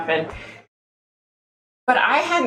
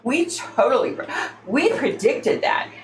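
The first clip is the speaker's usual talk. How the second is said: higher, breathless fake-psychic gushing; mocking the psychic sisters; 6 semitones higher than usual. Vlogger mocking YouTube psychics, high gushing fake-psychic voice. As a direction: gush like a phony psychic claiming credit. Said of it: "they jump on and say".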